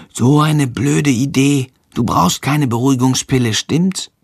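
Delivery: ordinary delivery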